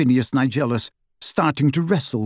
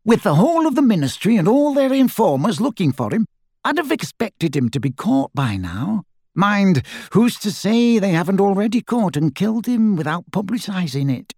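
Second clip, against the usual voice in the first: higher, animated character voice, doubtful; wondering aloud, pitched up; sceptical fugitive; 8 semitones higher than usual. A narrator's reading. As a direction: argue the grim possibility in a raised voice. Narrator voices Dirk, sceptical fugitive.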